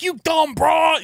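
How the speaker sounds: high, loud mock road-rage shout; slow, hammed-up angry yell; angry man. Imagined male driver to the woman he hit, mock angry shouting. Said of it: "If it was a man, he would have been like".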